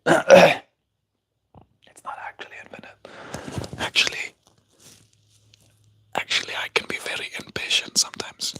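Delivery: stage whisper undercutting your own boast; whispered aside. Pretend. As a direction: whisper it like a secret admission. Whispered aside confessing against the boast.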